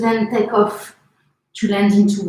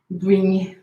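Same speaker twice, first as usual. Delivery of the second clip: normal talking voice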